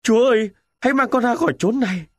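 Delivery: fast panicked plea to God; horrified cry. A narrator's reading. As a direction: cry out in horror at his own wound. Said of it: "Tôi kêu lên".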